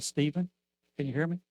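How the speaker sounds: first quiet call; calling softly. Retold or imitated. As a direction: call him gently, no answer expected. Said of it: "And I screamed".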